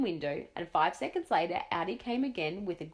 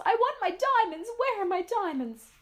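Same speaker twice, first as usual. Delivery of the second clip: very high, loud shrill demand; screaming Duchess; 14 semitones higher, 5 dB louder than usual. A narrator's reading. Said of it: "screamed the Duchess".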